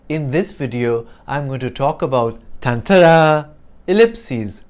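a loud "tantara" fanfare in place of the pause; sound effect fanfare. Shown as a demonstration. Teacher swaps the pause for a sound effect, with a loud fanfare. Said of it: "the pause for effect is like the tantara".

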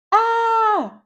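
high playful shriek of alarm; a child's pretend fright scream; mock scream. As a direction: scream like you just saw a monster. Rascal screams at a monster.

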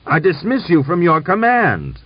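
loud, high-pitched dismissal. A narrator's reading. General dismissing the officer from command, loudly. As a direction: raise the voice in angry authority. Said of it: "raised his voice".

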